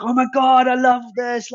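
high squealing delight, imitating the bartender; excited gushing. Bartender recognizing the brand, squealing with delight. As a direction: gush like a starstruck fan.

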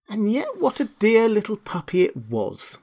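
high-pitched wistful little exclamation; fond Alice. A narrator's reading. Alice exclaims over the puppy.

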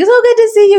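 high, loud imitation of a gushy greeting; effusive greeting. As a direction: gush it like a delighted acquaintance. Retold effusive goodbye to acquaintance.

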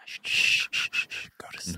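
whispered shushing to sleep; ASMR shushing. Pretend. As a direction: hush softly to sleep. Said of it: "our series of A S M R videos".

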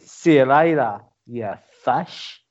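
a hammed-up New Zealand accent, fish as fush; mock New Zealand accent. Signing off the podcast in a jokey New Zealand accent, loud. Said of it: "I'll do New Zealand".

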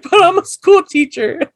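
high mock protest; imitating the man. Quoting the arrested teacher protesting, high whine. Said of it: "He's like".

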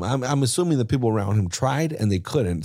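ordinary delivery

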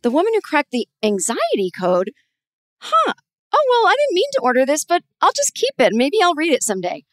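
puzzled, high-pitched double take at a book; bemused shrug, then keep it anyway; high, surprised mutter to herself. Retold or imitated. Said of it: "and I'm like".

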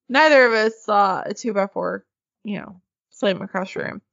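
ordinary talk

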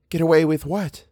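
a hint of dainty innocence. A narrator's reading. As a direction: ask it primly, a touch precious. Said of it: "Eleanor asked daintily".

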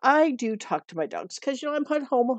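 ordinary talk

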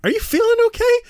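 high-pitched anxious fan; worried kid. Retold or imitated. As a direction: ask it like a nervous little kid. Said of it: "And you're like".

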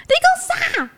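put-on a high, loud shout of a deaf old woman; loud old granny. Granny shouting back at the visitor, loud and high. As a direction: call out loudly as if hard of hearing. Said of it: "就很大声说".

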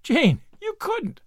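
high, slow, horrified plea; shocked disbelief. Acted. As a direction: stretch out the shocked protest. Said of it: "cried Anne, shocked".

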